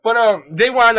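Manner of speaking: loud, high-pitched, tickled outburst; highly amused exclamation. Real, not acted. Recapper relishes Ogami trolling her, loudly amused. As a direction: exclaim it loudly, fresh from laughing. Still amused after laughing fit.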